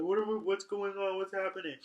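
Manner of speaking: mock alarm in an odd voice; freaked-out nobles. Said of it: "They're like".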